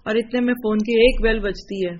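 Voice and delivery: ordinary talk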